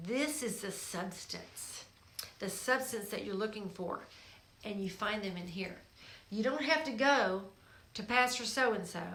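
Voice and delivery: normal talking voice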